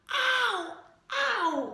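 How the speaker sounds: high, loud ow, ow; cries of pain. Acted. Girl with a twisted leg cries out ow. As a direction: yelp like a hurt child.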